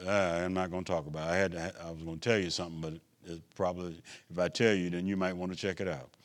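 low, soft and quick, backing off the subject; hesitant confiding aside. Real, not acted. Holding back something he meant to tell.

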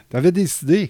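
high, loud mock reproach; mock scolding. Parent reproaching the gamer child, high and loud. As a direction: scold the kid for not following through.

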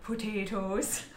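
slow, drawn-out hobbit potatoes; Samwise voice. Retold or imitated. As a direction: stretch it out like Samwise. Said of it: "I'm saying it that way 'cause of Samwise".

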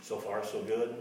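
ordinary delivery

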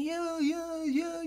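high, soft babble of agreement; stoned old man. Retold or imitated.

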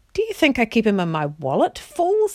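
indignant, pitch leaping high at the end; exasperated retort. A narrator's reading. Tyrion to dwarfs demanding to see Aslan, exasperated. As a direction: snap it back, rising high on the insult.

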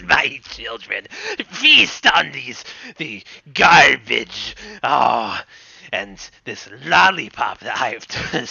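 gleeful villain. Acted. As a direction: gloat over your feasting bugs.

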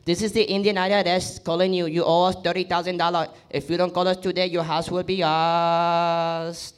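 put-on funny Indian accent. Scam IRS caller threatening a debtor, mock Indian accent. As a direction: put on an Indian accent, stretching the last word. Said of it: "in a funny accent".

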